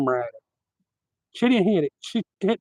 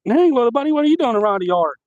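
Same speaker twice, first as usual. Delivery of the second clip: startled high call; 7 semitones higher, 5 dB louder than usual. Retold or imitated. Surprised to find an armadillo.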